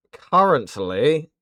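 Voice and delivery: drawn out and loud on currently am; loud and drawn-out for the chat; said really loud. Shown as a demonstration. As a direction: belt the last words out loud. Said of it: "Sorry, I had to say it really loud".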